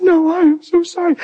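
high, emotional apology; high-pitched retold plea; imitated tearful insistence. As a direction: act out a wife insisting through tears. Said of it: "And I was crying".

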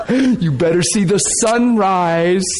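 laughing through the words; teasing, half laughing; chuckling preacher. Real, not acted.

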